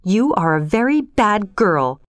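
understated, controlled scolding; restrained anger. A narrator's reading. Marilla tells Anne off, holding back anger. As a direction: reprimand firmly without raising the voice. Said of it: "trying not to raise her voice".

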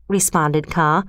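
ordinary delivery

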